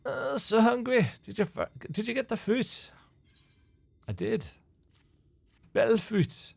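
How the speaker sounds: a hint of a playful pixie, reading the quest text; lightly voiced pixie. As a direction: tease it like a mischievous fairy. Voicing pixie NPC dialogue.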